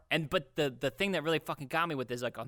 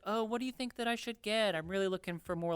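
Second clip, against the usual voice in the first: lady customer; 7 semitones higher than usual. Retold or imitated. Young shopper asking salesman about packages, lightly imitated lady. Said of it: "this lady came up to me".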